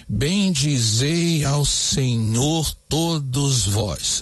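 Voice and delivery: very slow, every syllable separated; slow even scansion, no stress; syllable counting. Shown as a demonstration. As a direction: count each syllable evenly. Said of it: "vamos contar".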